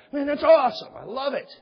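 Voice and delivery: high, loud and delighted; beaming enthusiasm; excited awesome. Shown as a demonstration. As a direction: gush over their answer. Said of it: "And that's how I say it, wherever I'm at".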